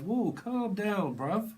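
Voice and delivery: high, slow, drawn-out whoa; hammed-up calm down, bruv; mock calming voice. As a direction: stretch the whoa, talking someone down. Acted reply to urgent message.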